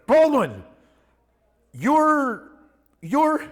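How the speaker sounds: put-on high, loud tipsy girl voice; imitating a drunk fan girl, high and loud.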